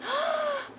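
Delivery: high delighted gasp; excited gasp. Real, not acted. Greeting a returning viewer.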